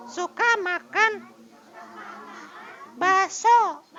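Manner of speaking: very high, slow tiny character voice; small voice. Shown as a demonstration. Teacher demonstrates the small voice for students, very high and slow. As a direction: squeak it out like a small child. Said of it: "suara yang kecil, yang kecil".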